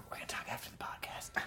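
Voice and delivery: whispered aside. Real, not acted. Promising the guest a private chat, whispered. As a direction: lean in and whisper it. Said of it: "whispered aside".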